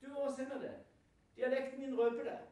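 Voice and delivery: high, loud accusation; accusing bystander. Retold or imitated. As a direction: call him out in front of the crowd. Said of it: "han ble tatt på dialekten sin".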